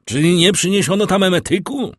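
high, loud urgent shout; calling out. A narrator's reading. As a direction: shout the question through the house. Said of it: "wybiegł wołając". (głośne, naglące wołanie)

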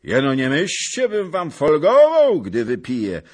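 sharp warning. A narrator's reading. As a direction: warn them sharply. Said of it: "rzekł ostro pan Zagłoba". (ostro, podniesionym głosem)

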